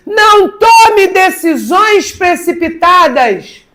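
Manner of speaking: loud, high-pitched fervent preaching; impassioned sermon delivery. Acted. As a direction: preach it out to the congregation, full force. Preaching at full force.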